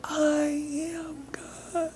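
a minuscule voice from far below heaven; an ant-sized "I am God"; tiny little voice. Retold or imitated. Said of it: "it's this tiny little".

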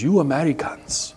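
measured, careful imitation of a Kenyan accent; slightly high, deliberate mock-Kenyan accent; awful Kenyan accent. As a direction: do an amateur Kenyan accent, each word set apart. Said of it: "you'll pardon the awful accent".

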